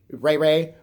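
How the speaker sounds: ordinary talk, nothing marked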